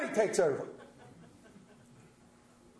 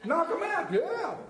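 high laughing reply; 9 semitones higher than usual. Real, not acted. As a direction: laugh through the words. Congregation answers, he laughs.

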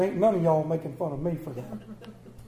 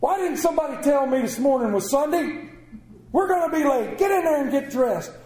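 loud high frantic father; hammed-up hurried farmer shout; mock yell; 9 semitones higher, 8 dB louder than usual. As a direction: shout at the family like he's late. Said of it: "He goes".

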